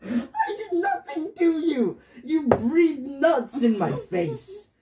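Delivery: high, slow, emphatic accusation; stretched-out, high-pitched indignation; indignant protest. Real, not acted. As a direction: protest your innocence and throw blame back. Child indignantly accuses mother.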